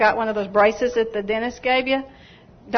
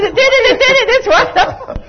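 high, loud and laughing; laughing excitedly; 12 semitones higher, 6 dB louder, faster than usual. Retold or imitated. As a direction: squeal it out through laughter. Retelling her joyful cry.

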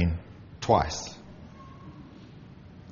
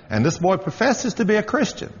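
pitched up in disbelief; can you believe it, raised high; a shocked, higher aside; indignant; 10 semitones higher than usual. Real, not acted. Indignant aside about the boy.

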